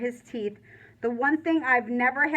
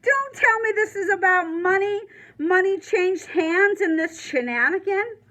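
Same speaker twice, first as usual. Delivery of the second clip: higher and louder fury; angry yell; 6 semitones higher, 5 dB louder than usual. Acted. Warden furious about money changing hands, yelling. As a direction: let the outrage spill out.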